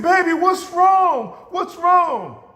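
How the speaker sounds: loud, high, drawn-out pleading; frantic mother. Retold or imitated. Mother to sobbing daughter, acted frantic concern. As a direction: cry out as an alarmed mom.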